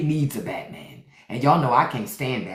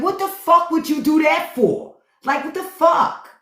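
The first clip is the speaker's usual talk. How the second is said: high, loud mock Jersey challenge; aggressive Jersey local; 13 semitones higher, 4 dB louder than usual. Jersey local picking a fight over nothing, hammed-up loud and high. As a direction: bark it like a Jersey guy spoiling for a fight. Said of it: "they'll say something like".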